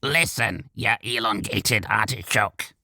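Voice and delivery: throaty, annoyed goblin; irritable goblin rasp. A narrator's reading. The goblin snaps at the elf, irritable rasp. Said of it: "he rasped irritably".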